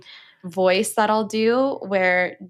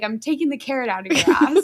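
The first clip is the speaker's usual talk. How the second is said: quick, higher playful partner voice; imitating Jack's goofy deadpan; partner's playful line; 6 semitones higher, faster than usual. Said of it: "he's like".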